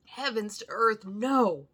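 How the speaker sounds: loud, high passionate refusal; stormy outcry. A narrator's reading. Arthur refusing Van Helsing, loud high outraged cry. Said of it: "cried Arthur in a storm of passion".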